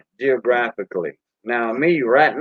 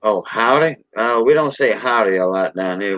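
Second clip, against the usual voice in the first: ordinary talk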